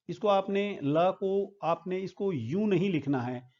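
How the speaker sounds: ordinary delivery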